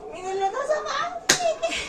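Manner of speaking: very high, slow voice asking "who cheated you?"; squeaky high question. Pretend. Influencer voicing a pretend caller, high and squeaky. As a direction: ask it in a tiny high voice, slowly. Playing both sides of dialogue.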